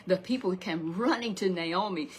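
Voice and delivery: usual speaking voice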